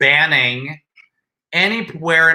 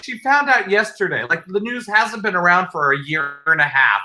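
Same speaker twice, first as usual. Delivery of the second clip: ordinary talk, nothing marked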